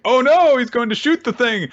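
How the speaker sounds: very high, loud cry of alarm, easing off; mock panic. Onlookers see he's going to shoot, mock panicked. Onlookers acted seeing the gun.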